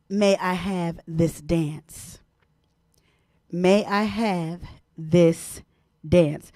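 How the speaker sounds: drawn out very slowly, word by word; dramatic slow announcement. Acted. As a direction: stretch every word like a grand reveal. Announcing tonight's topic.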